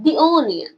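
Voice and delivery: "the" said "dee" before onion; long ee in "the", gliding into onion. Shown as a demonstration. Teacher asks how to pronounce it, "the" as dee. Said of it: "कैसे pronounce करोगे?".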